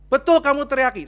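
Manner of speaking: loud, high-pitched accusing question; acted interrogation. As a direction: fire the accusation at full volume. Acted pastor interrogates the husband.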